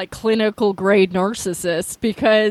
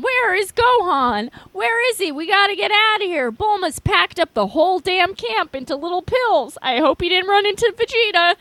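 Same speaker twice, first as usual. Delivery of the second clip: worried Krillin; 7 semitones higher than usual. Acted. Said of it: "Krillin paces around".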